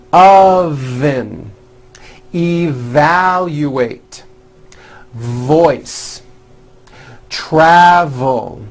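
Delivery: OVEN, EVALUATE, VOICE, TRAVEL slowly with long buzzed V; slow model words, each V drawn out; stretched V. Shown as a demonstration. Teacher leading word practice, slow buzzing V. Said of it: "practice some words with the V sound".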